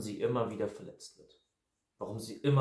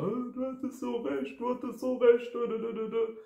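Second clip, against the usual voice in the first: much higher, mocking whimper; mock whiny weeping; 11 semitones higher than usual. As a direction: whine it like a sulky crybaby, much higher. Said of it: "wieder am Weinen ist".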